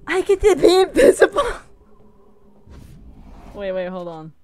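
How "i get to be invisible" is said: high, loud thrilled squeal; real excitement. Real, not acted. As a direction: cry out in delight at a new power. Thrilled at new game power.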